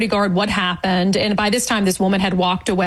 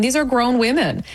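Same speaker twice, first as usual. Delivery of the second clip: ordinary delivery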